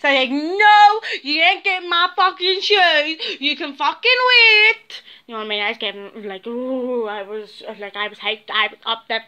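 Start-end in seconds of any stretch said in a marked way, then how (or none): 0.3-5.0 higher, louder, slow defiant retort; drawn-out angry no; mock angry refusal. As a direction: snap back that she's not getting them. Refusing someone her shoes.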